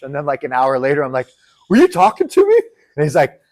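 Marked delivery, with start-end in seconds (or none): 1.7-2.6 high, loud squeaky query; incredulous retold question. Asking Chris an hour later, high comic disbelief. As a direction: ask it pitched way up, comically unsure.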